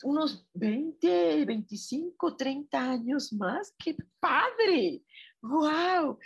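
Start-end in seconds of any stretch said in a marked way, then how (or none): 3.8-6.2 high, louder cheer of delight; thrilled. Real, not acted. Cheering a listener's age with delight.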